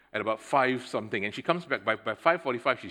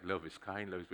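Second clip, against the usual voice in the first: quiet self-reminder; 6 semitones lower, 12 dB quieter than usual. Retold or imitated. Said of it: "I was like".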